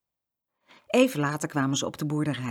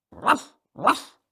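short, sudden barks; dog barking; 8 semitones higher, 4 dB louder than usual. A narrator's reading. Guard dog barking at children from kennel, barking. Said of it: "Kees blafte".

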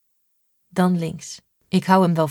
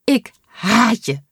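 slow, tearful, a bit higher and louder, Ik haat je with a quiver; trembling voice; 4 semitones higher, 4 dB louder, slower than usual. Acted. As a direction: choke out Ik haat je through tears of relief. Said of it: "zegt ze met trillende stem".

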